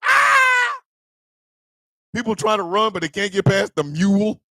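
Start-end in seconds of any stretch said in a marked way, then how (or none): 0.0-0.8 high shrieking panic yell; a churchgoer shrieks as snakes fall; mock scream. People fleeing the snakes.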